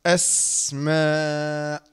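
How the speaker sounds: أسماء drawn out very slowly, lingering on the still سـ; resting on the sukun. Shown as a demonstration. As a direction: rest on the silent سـ in أسماء, then stretch the long vowel. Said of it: "واسترحت كده". (أسماء ببطء شديد مع الاستراحة على السين الساكنة)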